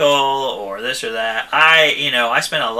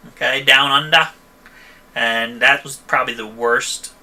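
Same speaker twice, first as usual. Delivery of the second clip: ordinary talk, nothing marked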